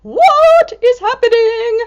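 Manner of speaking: high, loud bewildered cry; startled cat. Acted. Cat in the picture, high alarmed cry. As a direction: voice the cat's alarm. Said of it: "she's like".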